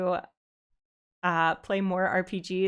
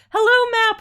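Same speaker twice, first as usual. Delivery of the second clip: high bright hello, much louder; excited greeting; 12 semitones higher, 9 dB louder than usual. Real, not acted. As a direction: call out a big welcome. Streamer greets a raider loudly.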